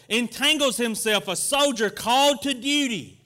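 loud pulpit preaching, driving each verse home; preaching at full force. Acted. Pastor preaching scripture to the church, loud and fervent. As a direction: preach the verse out to the whole congregation.

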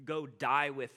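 mopey Thomas shrugging he'll go die too; gloomy Eeyore-like Thomas. Retold or imitated. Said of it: "who's kinda like Eeyore".

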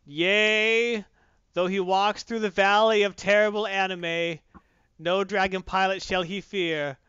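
booming, slow grand proclamation; mock biblical prophet. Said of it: "I said you don't fear it".

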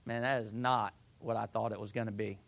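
sigh, then low, soft and quick; deflated, quietly acted disappointment; mock letdown. Sinner finds it wasn't the fun expected, mock letdown. Voices regret after doing wrong.

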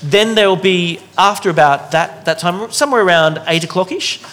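normal talking voice

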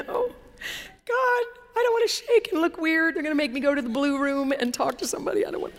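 high, anxious whine to God, acted out; mock panicked plea. Preacher retells begging God not to make her shake, mock panicked. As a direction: plead in a high voice, dreading embarrassment.

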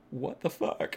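half-laugh it out, overwhelmed; laughing disbelief. Retold or imitated. Teary with his partner after the episode, laughing in disbelief. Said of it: "teared up, and I'm just like".

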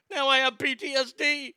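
high, far mocking voice of the complainer; sarcastic imitation of a victim; mocking whine. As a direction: mimic her whining. Mocking Underwood's claim.

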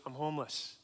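low, soft reply; quiet admission. Retold or imitated. Young man telling student why he's there, subdued. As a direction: drop the voice for a retold confession. Stranger quietly reveals homelessness.

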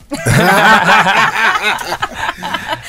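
high, long laughing fit; burst of laughter. Real, not acted. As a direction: laugh hard at your own joke. Laughing at own name joke.

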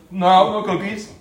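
put-on loud childish denial; fibbing kid. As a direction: play a caught child lying. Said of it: "he goes".

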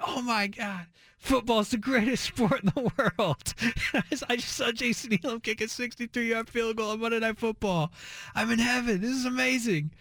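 put-on high, breathless, over-the-moon kid voice; giddy kid excitement. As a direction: gush like a thrilled six-year-old.

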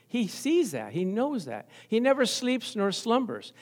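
normal talking voice

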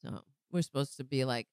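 usual speaking voice

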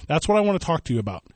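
usual speaking voice

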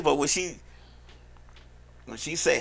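usual speaking voice

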